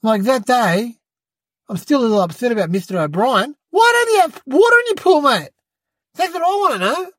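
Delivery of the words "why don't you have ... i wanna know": mock indignant. Kid scolds Mister O'Brien about his empty pool, mock indignant. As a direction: tell off the neighbour in jest.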